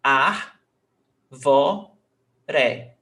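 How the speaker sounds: ar, vo, re with a gap after each; syllable by syllable. Shown as a demonstration. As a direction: break árvore into three separate beats. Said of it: "three syllables".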